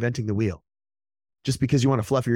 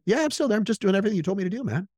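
breezy client reply; 7 semitones higher, faster than usual. Retold or imitated. Client's cheerful reply voiced.

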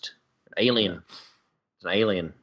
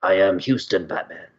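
Batman; 2 semitones higher than usual. Acted. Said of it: "Batman, yeah".

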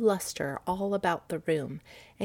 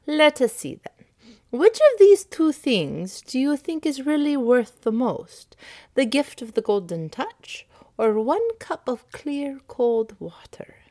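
higher and louder, slow riddling stranger; testing stranger; 6 semitones higher, 6 dB louder than usual. Acted. Stranger setting Midas a choice, slow and higher. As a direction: draw out each choice slowly.

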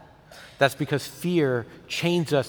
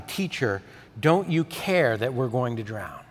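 usual speaking voice

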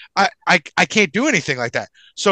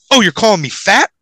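higher, louder feigned outrage; mock offence; 5 semitones higher, 6 dB louder than usual. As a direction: act loudly insulted for the crowd. Acts offended at the attendant.

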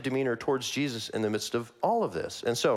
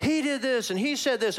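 high-pitched, rapid-fire mock accusations; accusing leaders; 10 semitones higher than usual. Elders accusing Jesus before Pilate, mock clamour. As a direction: fling accusations like an agitated crowd. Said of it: "the elders and the leaders are like".

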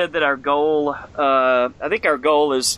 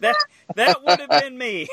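high laughing confession; giggling as he owns up; 10 semitones higher than usual. Real, not acted. Host laughing as he admits the noise was him.

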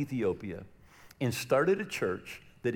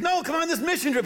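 insisting; 5 dB louder than usual. Retold or imitated. Retold urging Rick.

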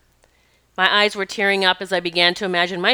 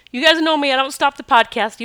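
higher and louder, pushing through tears; tearful; 5 semitones higher, 4 dB louder than usual. Real, not acted. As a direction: talk on bravely while crying. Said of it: "even if I cry".

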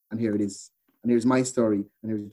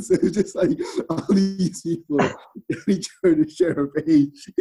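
laughing; 8 semitones higher than usual. Real, not acted. Laughing while retelling snowball.

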